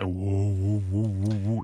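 warbled, muffled underwater voice; talking underwater. Retold or imitated. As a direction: gurgle it like you're underwater. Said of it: "You ever try to talk underwater?".

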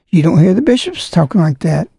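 usual speaking voice

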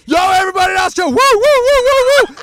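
high, very loud shout and whoops; pumped-up frat boy. Retold or imitated. Wrestler greeting Austrian viewers, high hyped whooping. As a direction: whoop wildly like a party boy. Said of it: "He goes".

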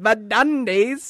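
The Dundies said slow, high and loud, savoring it; D'Angelo Vickers impression. Imitating a TV character announcing the awards, slow and loud. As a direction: draw out The Dundies like a pompous awards host. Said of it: "where he's like when Michael's training him".

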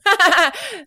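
high, loud mock laugh; imitated laughing at someone. Host acts out laughing at their English, high mock laugh. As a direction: laugh loudly as if making fun. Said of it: "and I was like".

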